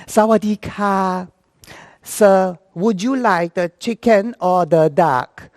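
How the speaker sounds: put-on slow, drawn-out Thai accent; Thai flight attendant. Thai attendant offering strangers a meal, slow Thai accent. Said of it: "flight attendant said to the strangers".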